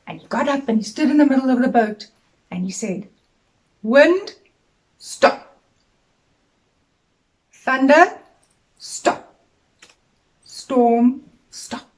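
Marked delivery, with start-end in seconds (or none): 3.8-11.9 commanding the storm. Acted.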